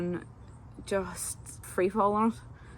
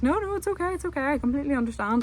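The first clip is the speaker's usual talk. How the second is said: high, quick soothing voice; mock soothing doorman; reassuring bartender; 7 semitones higher, 3 dB louder, faster than usual. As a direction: placate her gently and fast. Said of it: "he was like".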